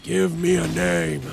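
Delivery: slow, drawn-out ominous demand; dramatic demand. Pretend. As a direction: stretch it like a movie threat.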